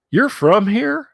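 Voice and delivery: high, loud, incredulous question; surprised question. Retold or imitated. Retold cousin's friend's surprised question.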